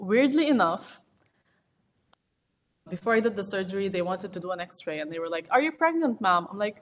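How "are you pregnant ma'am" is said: high, louder question from the X-ray tech; quizzing hospital staff. Retold or imitated. X-ray staff questioning the patient before surgery, pitched-up loud ask.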